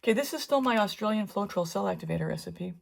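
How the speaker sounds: ordinary talk, nothing marked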